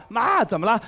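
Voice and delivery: loud phone reply. Retold or imitated. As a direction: re-enact a loudish call to mum. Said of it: "你也挺大声".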